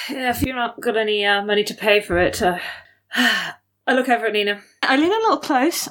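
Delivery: normal talking voice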